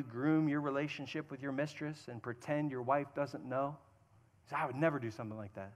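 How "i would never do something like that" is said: quick, low, soft protest; mock denial. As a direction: play a husband's hushed, hurried denial. Voices a husband's denial.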